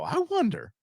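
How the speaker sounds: high, sing-song musing; mock wondering. Dad checks his son's posts, wondering in a high voice. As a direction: pitch it way up, playfully curious.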